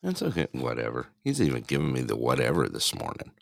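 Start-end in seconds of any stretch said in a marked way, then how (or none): 0.0-0.5 high mocking echo. Echoing partner's "whatever. 0.5-1.1 dismissive mock whatever. Echoing partner's "whatever.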